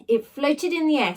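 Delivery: usual speaking voice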